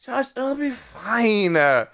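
slow, drawn-out tipsy reassurance; drunk dad. Retold or imitated. Son mimics drunk dad reassuring him, slow and drawling. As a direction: stretch the words like a woozy dad. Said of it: "I'm not that fucking drunk".

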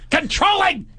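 high, campy accusing cry; queeny. Retold or imitated. Friend accusing the comic, queeny huff. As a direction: drag it out in a mock huff. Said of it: "he starts getting Queenie".

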